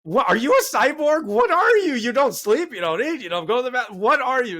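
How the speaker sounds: high, loud disbelieving demand, mock outrage; incredulous shout. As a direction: yell it at him like he's a machine. Demanding Gary explain himself.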